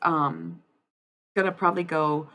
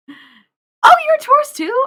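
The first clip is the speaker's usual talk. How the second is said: high, loud burst of delight; sudden squeal of excited recognition; excited outburst; 14 dB louder than usual. Real, not acted. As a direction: shout it, thrilled at the discovery. Delighted to find a fellow Taurus.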